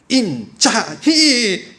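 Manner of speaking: name drawn out slowly and pitched high; boastful name. Retold or imitated. Child bragging to his uncle, slow and high. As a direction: proudly stretch out his own name.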